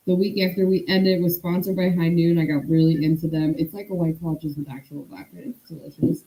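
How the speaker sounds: usual speaking voice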